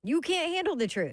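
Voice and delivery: high, punchy film quote; hammed-up courtroom retort; movie-line outburst. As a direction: throw out the famous line with mock force. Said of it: "what's the next line".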